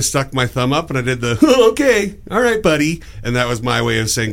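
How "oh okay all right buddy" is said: mock-friendly high "Oh, okay"; sarcastic. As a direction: fake cheer that means go away. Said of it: "So I usually go straight to sarcastic".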